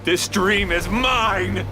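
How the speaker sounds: slow, high, insistent claim; defiant shout. Acted. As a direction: declare it with fierce pride. Villain claims the dream.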